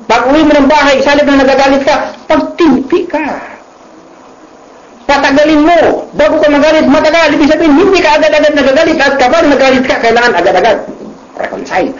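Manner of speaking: high, urgent preaching push, fast in places; fervent preaching. Acted. Preacher urging the congregation to hold back anger, fervent and high. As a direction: drive the advice home like a pulpit appeal. Preacher exhorts listeners at home.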